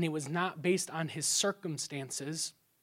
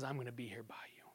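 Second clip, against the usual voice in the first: fast soft whisper, much softer; whispered reassurance; 6 semitones lower, 17 dB quieter, faster than usual. Retold or imitated. God reassuring his people, soft whisper. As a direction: whisper it like comfort in the ear. Voicing God's comfort.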